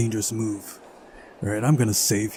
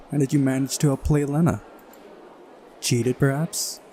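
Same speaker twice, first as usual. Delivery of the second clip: a slightly raised, far-off-your-own voice; lightly voiced teasing girl character; 3 semitones higher than usual. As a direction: lightly play a needling opponent. Voicing Uliana, teasing game character.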